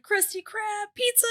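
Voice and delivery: high, soft cartoon voice; a cartoon line quoted in a high thin voice; SpongeBob quote. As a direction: squeak it out like a cartoon. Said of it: "like that episode of SpongeBob where it's like".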